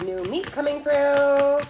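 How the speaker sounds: slow, loud, drawn-out announcement; mock movie quote. Tarot reader acting out the movie boy clearing the hall, slow and loud. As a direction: stretch it out, loud, like a path being cleared. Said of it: "and he's like".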